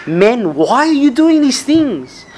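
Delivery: slow, high anguished cry of "Men". Retold or imitated. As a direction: cry out in dismay at the crowd. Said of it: "crying out and saying".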